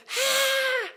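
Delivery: high sharp yelp of pain; child's yelp. Retold or imitated. Milo grazes the hot pan, high yelp.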